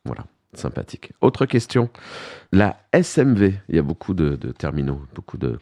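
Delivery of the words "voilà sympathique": whispered ironic aside, barely voiced; sarcastic whisper. Real, not acted. Host mocking the idea under breath, sarcastic whisper. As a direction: breathe it out as a dry jab.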